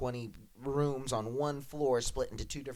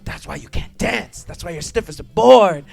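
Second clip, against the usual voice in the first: mock scolding teacher; 5 semitones higher, 13 dB louder than usual.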